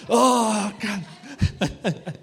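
loud, high cry breaking into laughter; retold ballistic shout. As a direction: re-enact an overwhelmed shout to God, then laugh. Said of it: "I shout, I go ballistic and I get slain".

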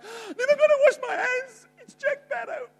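high, swooning fan voice; a groupie gushing over Jack Sparrow, high and swooning; starstruck groupie. Retold or imitated. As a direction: gush like an obsessed fan. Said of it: "they're like".

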